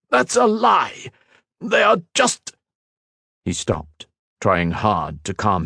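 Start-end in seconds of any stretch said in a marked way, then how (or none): 0.1-2.5 much higher, slow outburst; furious denial. A narrator's reading. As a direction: explode at the accusation.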